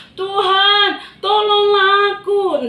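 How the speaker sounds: high, loud, slow desperate cry; crying out for help. Acted. Peter sinking in the waves, crying out. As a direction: plead to be saved from sinking. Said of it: "kubat teriak".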